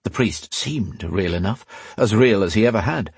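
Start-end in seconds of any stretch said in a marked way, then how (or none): none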